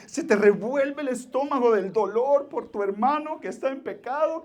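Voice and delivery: loud, high, impassioned pleading sermon; preaching at full force. Acted. Pastor pleading with his church for real tears, fiery preaching. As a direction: call the church to weep, pushing hard.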